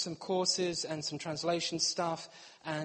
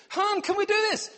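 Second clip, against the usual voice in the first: high, louder sweet wife voice; mock wife asking; 12 semitones higher, 6 dB louder than usual. Wife asking her husband for something, high sweet voice. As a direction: ask sweetly like his wife. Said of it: "So Kate would speak to me".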